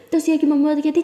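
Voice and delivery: ordinary delivery, nothing marked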